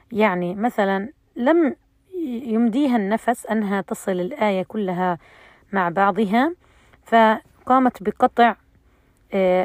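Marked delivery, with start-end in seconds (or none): none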